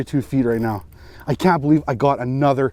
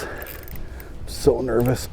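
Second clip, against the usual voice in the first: slow, whispered nerves; nervous whisper; 6 dB quieter, much slower than usual. Real, not acted. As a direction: whisper it slowly, holding breath.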